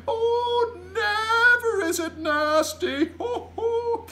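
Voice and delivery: loud, very high, stretched-out protest; gleeful high bellow, drawn out; giant's exuberant cry. A narrator's reading. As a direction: sing its praises in a big giant voice. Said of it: "cried the B F G".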